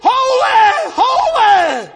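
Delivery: high, loud interrupting holler; Brother Paul. Retold or imitated. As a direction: break in with an ecstatic imitated shout. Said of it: "Paul looked at them shoes".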